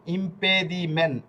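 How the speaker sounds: ordinary talk, nothing marked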